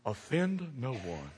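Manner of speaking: mock slogan. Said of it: "What image have we bowed down to?".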